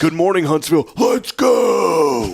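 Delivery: high radio greeting building to a strained yell; loud, hyped-up parody. Acted. Host parodying a greeting to Huntsville, loud and hyped. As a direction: overblown morning-show hype scream. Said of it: "loud, jittery, and oddly aggressive".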